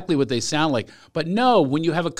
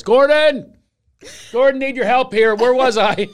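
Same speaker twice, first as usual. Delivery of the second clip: calling out; 8 semitones higher, 5 dB louder than usual. Real, not acted. Calling producer for help.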